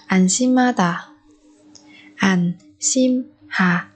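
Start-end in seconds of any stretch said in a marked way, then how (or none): 2.2-3.8 slow, separated "Anshimhada"; syllable by syllable. Shown as a demonstration. Teacher modelling a word for learners, slowly spaced out. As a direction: pause after each syllable of "Anshimhada".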